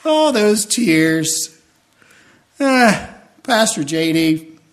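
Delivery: lightly voiced, amused heavenly onlookers teasing from above; departed loved ones from heaven. Pastor voicing the departed, teasing him at the funeral from heaven, lightly amused. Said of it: "I just sense they're looking down on me, going".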